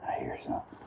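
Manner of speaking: soft whisper to self. Real, not acted. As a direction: hush it as if listening. Quiet aside, listening.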